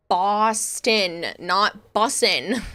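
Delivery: Boston set apart from bussin', bussin' drawn out slowly; slow correction. Shown as a demonstration. Correcting chat who hear Boston as bussin', slowly. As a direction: spell out the difference slowly for chat. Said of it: "How you people want me to say this".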